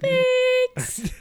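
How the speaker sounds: fake-sweet little squeak; mock sweet thanks. Owner to humping cat Thompson, high squeaky sarcastic thanks. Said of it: "I'm like".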